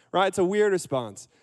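normal talking voice

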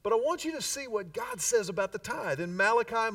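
ordinary delivery

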